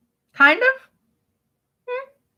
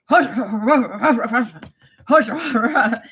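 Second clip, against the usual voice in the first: slow, breathy grandmother gibberish; Nona; 2 semitones lower, slower than usual. Retold or imitated. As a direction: imitate a grandmother babbling in no language. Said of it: "you're not speaking any language".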